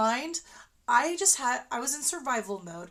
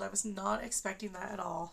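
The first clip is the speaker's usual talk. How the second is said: usual speaking voice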